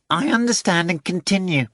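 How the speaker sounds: a fat cat bragging and wheedling; boastful cat Behemoth. Acted. Behemoth swearing to Voland, high wheedling cat voice.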